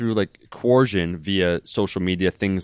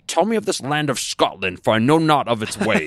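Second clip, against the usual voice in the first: put-on higher-pitched Scottish-accented film line; Sean Connery impression; 5 semitones higher than usual. Podcaster quoting the Highlander line to co-hosts, mock Scottish accent. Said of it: "he's like".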